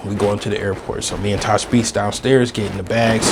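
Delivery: usual speaking voice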